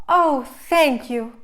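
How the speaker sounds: thanking the godmother in wonder; grateful cry. A narrator's reading. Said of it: "Cinderella cried".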